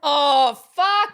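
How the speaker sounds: loud, high exclamation; real groaning expletive at full volume; exasperated outburst. Real, not acted. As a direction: burst out in exasperation. Listener exasperated by the teacher's advice.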